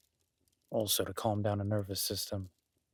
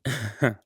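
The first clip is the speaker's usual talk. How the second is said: a brief, bright chuckle; short laugh; 3 semitones higher, 7 dB louder than usual. Acted. Chuckling at the guest's apology. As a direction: chuckle before the tease.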